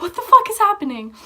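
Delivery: high, loud, panicked retelling; mock hysterical screaming. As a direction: cry it out in hammed-up panic. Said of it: "I'm screaming hysterically".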